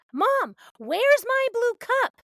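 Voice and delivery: put-on high, slow whiny child demand; demanding kid voice. Toddler yelling at mom for her blue cup, high whiny demand. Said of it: "they're yelling demands".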